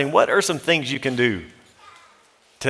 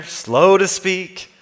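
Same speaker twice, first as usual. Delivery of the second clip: slow, deliberate delivery, showing the advice; leaning on "slow" and letting "slow to speak" crawl; drawn out slowly; 4 semitones higher, much slower than usual. Shown as a demonstration. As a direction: stretch each word out slowly. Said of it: "slow your roll".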